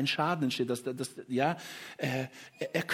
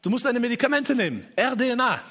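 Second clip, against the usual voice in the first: higher, louder mock child's urging; imitating his son; 8 semitones higher, 5 dB louder than usual. Father retelling how his son told him off, higher and insistent. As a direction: play the son ordering Papa to take his medicine. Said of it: "dann kommt der dritte Sohn und sagt".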